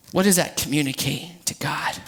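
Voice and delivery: low hushed whisper. Real, not acted. Preacher whispering a question to the congregation.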